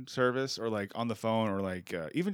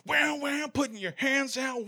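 high-pitched, wailing mock guitar; air guitar imitation. Shown as a demonstration. Host demonstrating air guitar to a friend, wailing guitar noises. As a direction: wail the words like an electric guitar solo.

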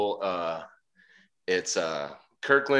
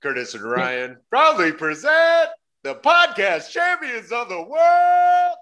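booming ring announcer, rising higher and louder to a drawn-out shout; ringmaster; 8 semitones higher, 7 dB louder than usual. Retold or imitated. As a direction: build it into a huge slow arena call. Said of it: "remixed version of the New Age Outlaws".

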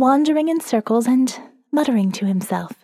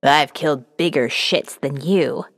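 much lower, rough-edged Jeb insult; Jeb's voice; 8 semitones lower than usual. Acted. Narrator quoting Jeb's classic insult, in a low voice. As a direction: drop the voice low and throw it like a brawler. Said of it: "my little notebook of Jeb-isms".

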